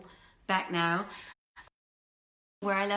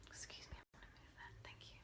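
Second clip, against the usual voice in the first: quick whisper off to the side; whispered aside; 25 dB quieter, faster than usual. Real, not acted. Performer whispering to a stagehand mid-show, hushed. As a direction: drop to a whisper to ask someone a favour. Aside to someone during reading.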